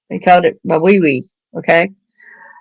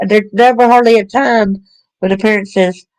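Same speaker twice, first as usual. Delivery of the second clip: usual speaking voice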